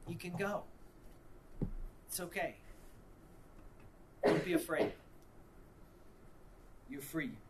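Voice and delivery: soft, gentle reassurance with long pauses; whispering Father. Acted. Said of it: "hear the Father whisper in your ear".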